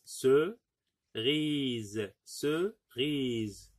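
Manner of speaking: "cerise" split as ce, long pause, rise; syllable by syllable. Shown as a demonstration. Teacher helping pupils find the i, "cerise" in separate syllables. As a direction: hold apart the two syllables of "cerise". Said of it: "que deux syllabes".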